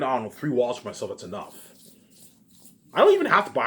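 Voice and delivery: ordinary talk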